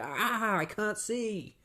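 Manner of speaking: high-pitched hammed-up wail; mock pained cry. Batman hit in the face with perfume, mock anguish. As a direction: cry out as if suddenly blinded.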